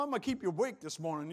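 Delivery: ordinary talk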